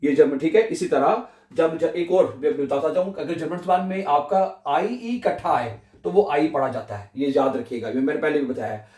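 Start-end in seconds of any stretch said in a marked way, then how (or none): none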